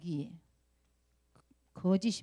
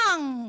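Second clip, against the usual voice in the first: loud, higher mock wail; imitated child's cry; 5 semitones higher, 5 dB louder, much slower than usual. Teacher shows Sua crying when trouble comes, mock wail. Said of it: "하고 나오는 거죠".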